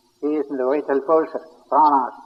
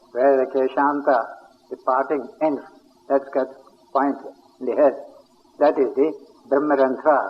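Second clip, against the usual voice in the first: normal talking voice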